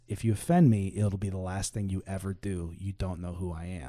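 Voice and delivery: low, quiet menace; retold threat. Retold tough man threatening pastor.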